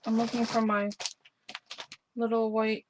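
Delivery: talking while crunching chips; words round a mouthful of chips. Real, not acted. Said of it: "Sorry for the crunching again".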